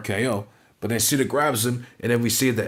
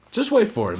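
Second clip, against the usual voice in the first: much higher and louder, a burst of hype; excited payoff; 11 semitones higher, 3 dB louder than usual. Shown as a demonstration. Hyping viewers about the ending, high excited burst. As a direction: jump up in pitch to sell the surprise. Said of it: "And just wait for it".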